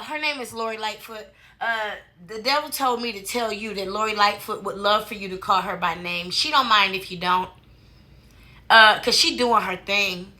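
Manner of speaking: ordinary talk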